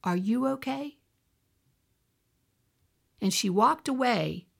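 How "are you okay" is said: soft, tender question with rising end; gentle Holy Spirit. Retold or imitated. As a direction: ask it gently, imitating an inner voice. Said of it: "the Holy Spirit said to me".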